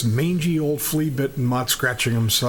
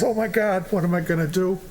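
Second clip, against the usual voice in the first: higher-pitched mock dismay; lightly acted cry of dismay; dismayed defendant; 7 semitones higher than usual. Sentenced woman laments to herself in court, mock dismay.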